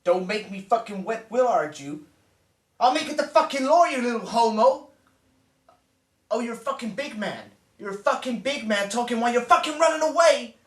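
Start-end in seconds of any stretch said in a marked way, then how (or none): 2.8-4.9 fast, high, loud threat; rapid heated outburst; angry threat. Acted. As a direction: lay down the law furiously. Threatening in a quarrel.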